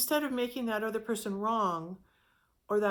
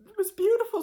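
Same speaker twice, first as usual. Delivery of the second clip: delighted; 12 semitones higher, 7 dB louder, faster than usual. Real, not acted. Delight at the restaurant sign.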